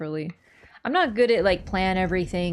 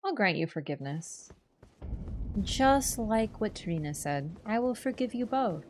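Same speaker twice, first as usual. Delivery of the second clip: mock forgiveness; 8 dB quieter than usual.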